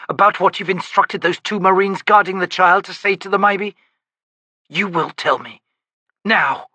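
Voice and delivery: furious woman confronting her lover, icy; icy fury. A narrator's reading. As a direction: build a hard, cold demand. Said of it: "grated in an icy voice".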